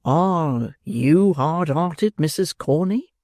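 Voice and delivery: drawn out, very slow coaxing question; slow wooing beadle. Acted. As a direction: linger on each word, courting. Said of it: "said Mister Bumble".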